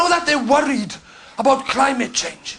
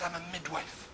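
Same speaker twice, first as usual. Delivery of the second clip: much softer, low "I'm a midwife"; quiet reply; 7 semitones lower, 22 dB quieter than usual. Retold or imitated. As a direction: answer softly, almost to yourself. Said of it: "She said".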